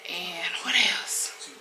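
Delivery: slow unvoiced whisper to herself. Real, not acted. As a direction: breathe it, slowly, to yourself. Quietly wondering aloud.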